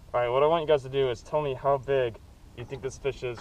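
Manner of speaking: ordinary talk, nothing marked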